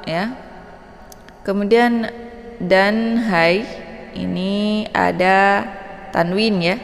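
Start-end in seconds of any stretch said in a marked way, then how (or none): none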